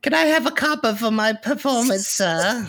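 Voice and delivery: very high, squeaky begging urchin; Dickensian street urchin. Acted. Joking as an urchin begging payment for a performance, squeaky mock street urchin. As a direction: ham up a tiny orphan begging for coins. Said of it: "a Charles Dickens street urchin".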